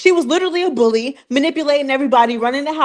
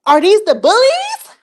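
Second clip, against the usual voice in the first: higher and louder, sarcastic; mocking question; 7 semitones higher, 5 dB louder than usual. Vlogger mocking the cast bullies, sarcastic and louder. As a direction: sneer the question up high.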